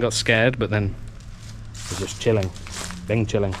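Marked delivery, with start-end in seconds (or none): none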